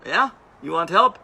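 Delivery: high-pitched take on a tall Tanzanian man; imitated local helper. Tall local offers the stuck rider help, imitated in a high voice. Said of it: "I can't talk in their accent".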